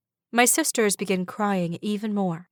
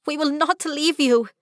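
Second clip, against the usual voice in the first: protesting; 8 semitones higher, 4 dB louder than usual. Acted. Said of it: "Patina protests".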